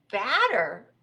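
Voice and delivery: loud one-word exclamation; puzzled cry. Acted. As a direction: cry out in bafflement. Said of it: "cried Rabbit".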